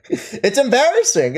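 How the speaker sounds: laughing through it, slowly. Real, not acted. Streamer laughing at a chatter's embarrassing behavior. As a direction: chuckle the words out in disbelief.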